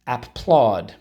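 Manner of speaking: normal talking voice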